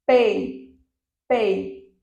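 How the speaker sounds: teacher showing the syllable; syllable demo. Shown as a demonstration. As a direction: model "pei" as one syllable. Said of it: "Então, observem".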